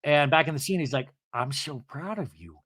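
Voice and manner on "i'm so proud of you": softer imitated tearful pride; understated retold choked-up moment; tearing up. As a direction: say it quietly, mock-moved. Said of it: "he starts tearing up".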